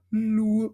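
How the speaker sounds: sung "Lu" with a big L. Shown as a demonstration. Choir director shows the onset of "Lu", big L. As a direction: lean into the L that starts "Lu". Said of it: "big L at the beginning".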